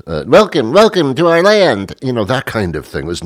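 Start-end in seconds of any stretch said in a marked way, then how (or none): 0.3-1.9 lightly voiced singsong Munchkin greeting; a hint of an Oz Munchkin welcoming Dorothy; Munchkin welcome. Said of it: "like I'm Dorothy in The Wizard of Oz".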